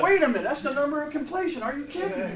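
high, amazed and laughing; sudden epiphany. Retold or imitated. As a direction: gasp it out as the penny drops. His own epiphany, retold.

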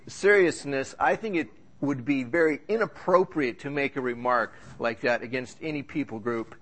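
normal talking voice

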